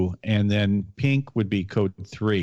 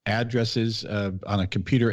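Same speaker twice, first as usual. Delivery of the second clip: ordinary talk, nothing marked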